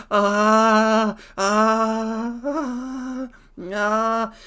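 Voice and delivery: high, loud excited ahs; giddy high-pitched ah ah ah; excited squeals. Real, not acted. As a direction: squeal with delight at the idea. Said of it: "I want to go listen to music".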